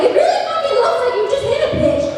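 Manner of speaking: high, fast alarmed exclamation. Retold or imitated. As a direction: blurt it out in shock. Alarmed retold quote, high.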